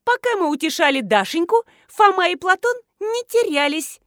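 high, agitated woman's voice; shocked realisation, lifted high; aghast. A narrator's reading. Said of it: "ахнула Марина".

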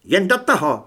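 high, loud sharp challenge; barked dare. A narrator's reading. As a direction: bark at him to go ahead. (vyštěknutá výzva)

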